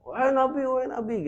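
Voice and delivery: high-pitched imitation of calling from afar; mock calling out. Bedouin calls the Prophet from afar, mock calling out. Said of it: "sudah teriak-teriak manggil".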